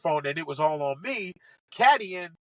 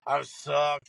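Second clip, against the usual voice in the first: mock whining; 6 semitones lower than usual. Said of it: "he's bitching".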